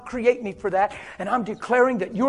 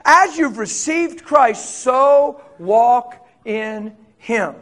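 very slow, louder measured reading; slow scripture reading; 4 dB louder, much slower than usual. Shown as a demonstration. Preacher reading Colossians to the congregation, very slow. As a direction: read each word slowly. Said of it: "Colossians chapter two".

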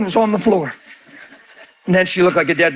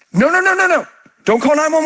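high, urgent calls of no; high pleading no, no, no; 8 semitones higher than usual. Retold or imitated. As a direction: frantically wave the crowd off. Frantically telling crowd not to call.

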